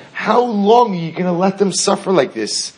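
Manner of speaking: pleading angels. Acted. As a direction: cry out to God on behalf of his children.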